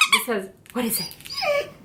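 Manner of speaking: high soft coo at the dog; whisper-coo to the pup; high soft pet coo. Real, not acted. Asking dog in baby talk.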